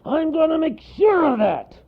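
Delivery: loud, strained furious bellow; angry bear at full volume; bear's roar. A narrator's reading. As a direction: roar it as a threat. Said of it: "roared the bear".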